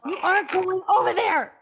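upset cry of protest; strained protest. Acted. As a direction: fight her off. Struggling protest.

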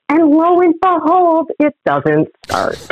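slow down for the big reveal; drawn-out lo and behold; a slow, theatrical build to the punchline; dramatic slow reveal. Acted.

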